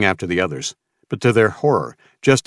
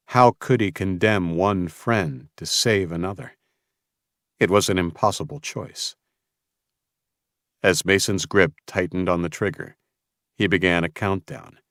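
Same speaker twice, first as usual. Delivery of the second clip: ordinary delivery, nothing marked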